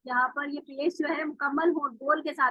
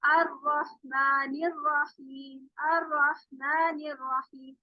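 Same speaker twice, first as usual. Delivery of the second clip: slow recitation; 3 dB quieter, much slower than usual. Shown as a demonstration. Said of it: "ऐसे ही".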